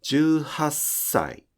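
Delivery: 十八歳 stretched very slowly with an unbroken s hiss; very slow teacher demo of 十八歳; very slow model word. Shown as a demonstration. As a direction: drag out 十八歳 so the hiss never stops. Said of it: "ずっと音が聞こえているはずです".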